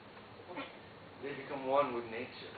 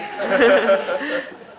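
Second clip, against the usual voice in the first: loud, high burst of laughter; 5 semitones higher, 15 dB louder than usual. Real, not acted. Laughing at his own story.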